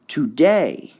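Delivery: Today with a rise-fall; stress test on Today. Shown as a demonstration. Teacher trying stress on Today. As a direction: try Today, stress swung. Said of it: "one you really have to hear".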